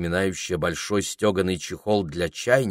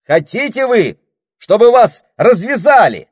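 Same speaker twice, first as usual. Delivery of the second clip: loud high shouted question; shouting; 13 dB louder than usual. Acted. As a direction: keep yelling to be heard. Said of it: "прокричал Саймон".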